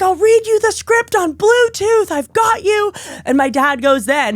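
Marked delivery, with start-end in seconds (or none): 0.0-2.9 high supportive mock mom; lightly high mom voice; imitating mom. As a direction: pitch up, eager to help. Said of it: "And Mom's like".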